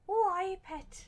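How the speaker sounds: badly faked northern English accent. Shown as a demonstration. Demonstrating accents to viewers, mock northern English accent. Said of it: "a more northern accent is".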